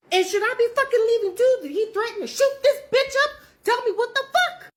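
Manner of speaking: high and loud, frantic demands; blocking the door, yelling frantically at a friend; rising panic. Retold or imitated. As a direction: push her for answers in a panic. Panicked questions at her coworker.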